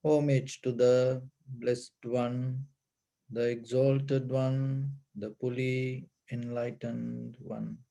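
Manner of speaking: slow, measured devotional recitation; solemn recitation. Real, not acted. Teacher giving the homage in English after chanting, solemn recitation.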